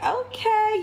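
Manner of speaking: 兴奋地尖声说好; high excited okay. Retold or imitated. Excited reaction to gelato offer.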